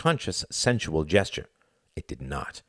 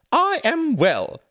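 slow, very high, exaggerated primness; mock formality; 6 dB louder, much slower than usual. Greta answers Klaus with a mock-formal bow, very high and slow. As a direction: play at being terribly proper. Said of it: "she said in mock formality".

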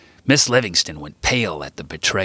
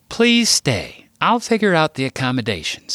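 Sarah voiced; 8 semitones higher than usual. A narrator's reading. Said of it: "Sarah said".

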